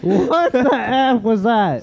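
laughing. Real, not acted. Shocked at the prank call.

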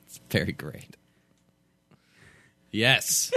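normal talking voice

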